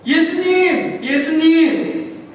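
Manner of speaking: loud, high call, the last one slow and drawn out; shouting his name. Acted. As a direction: yell the name out over the waves. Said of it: "제자들은 외칩니다".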